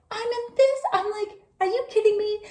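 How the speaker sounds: high-pitched outraged protest; indignant disbelief. Retold or imitated. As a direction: squeal it in offended disbelief. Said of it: "I was pissed".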